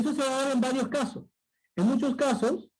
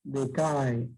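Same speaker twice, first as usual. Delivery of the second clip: "decae" drawn out, sinking low; falling intonation demo; 9 semitones lower than usual. Shown as a demonstration. Teacher showing pupils a decreasing diphthong, falling intonation. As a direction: let "decae" slide down in pitch as it ends. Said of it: "pero luego la entonación".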